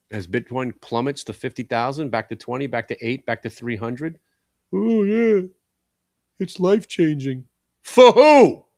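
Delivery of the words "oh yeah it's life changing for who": higher, louder mock enthusiasm; sarcastic mockery. Mocking Bitcoin fans to his viewers, sarcastic and loud. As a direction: ham up the hype, then undercut it.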